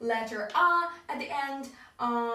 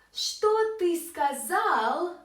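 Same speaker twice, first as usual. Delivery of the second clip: slow, drawn-out, higher and clear; slow teaching pronunciation; 6 semitones higher, 4 dB louder, slower than usual. Shown as a demonstration. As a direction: say it slowly for learners. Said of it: "what did you just say".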